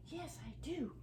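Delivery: breathy little whisper as the dog. Pretend. Owner voices her dog's eager answer, whispered. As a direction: hush it out like the dog agreeing.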